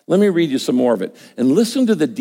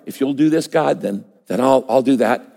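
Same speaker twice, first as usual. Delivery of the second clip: ordinary talk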